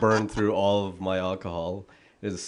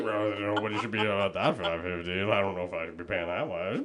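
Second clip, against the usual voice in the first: low, softer mumbling-to-yourself impression; imitating low voice; 4 semitones lower, 5 dB quieter than usual. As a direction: mimic a friend dropping down into a serious private register. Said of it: "you speak really low".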